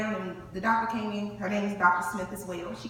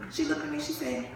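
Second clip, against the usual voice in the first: ordinary delivery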